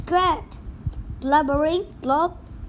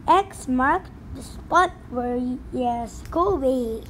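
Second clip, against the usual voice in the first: usual speaking voice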